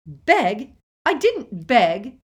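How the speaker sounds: loud, high outrage; indignant denial. A narrator's reading. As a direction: deny it loudly, affronted. Petunia indignant at accusation.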